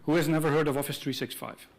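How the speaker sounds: normal talking voice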